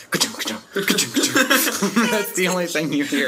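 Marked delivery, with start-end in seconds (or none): 0.1-1.9 train noise imitation. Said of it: "it's more like".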